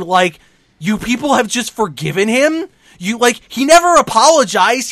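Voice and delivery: loud, incredulous acted tirade; indignant rant. Acting Johnny's angry rant at fans.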